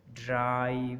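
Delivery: ड्राइव with a long held AI; drawn-out ड्राइव to show the long vowel; lengthened vowel. Shown as a demonstration. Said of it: "vowel को लंबा कर देता है".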